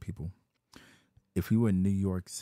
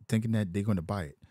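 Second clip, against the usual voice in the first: ordinary delivery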